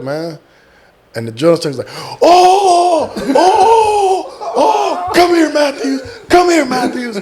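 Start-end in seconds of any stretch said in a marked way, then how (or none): 2.2-7.2 laughing. Retold or imitated. Drill instructor laughing, calling him.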